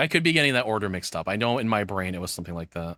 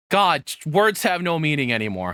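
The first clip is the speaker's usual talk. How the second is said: exasperated outburst; 6 semitones higher, 5 dB louder than usual. Real, not acted. Frustrated rant about misused words.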